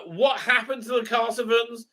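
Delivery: ordinary talk, nothing marked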